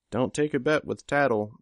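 chiming in. A narrator's reading. Regent butting in to warn Gru off a bet, chiming in. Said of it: "Regent chimed in".

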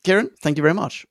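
usual speaking voice

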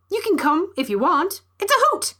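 high, bright-pitched little bird voice rising on hoot; chirpy bird. Acted. Bird on the antelope's shoulder inviting the neighbours, high chirpy voice. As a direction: play the plump bird, squeaking upward.

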